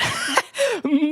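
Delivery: high-pitched giggling burst; squeaky laugh; high giggle. Real, not acted. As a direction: giggle at how bad it sounds. Laughing at bad amp.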